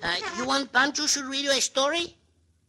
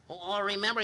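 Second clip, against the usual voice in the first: usual speaking voice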